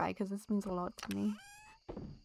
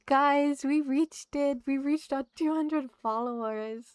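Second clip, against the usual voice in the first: higher and louder, breathless joy; excited announcement; 5 semitones higher, 7 dB louder than usual. Real, not acted. Streamer tells chat they reached the follower goal, thrilled. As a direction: tell the chat you made it.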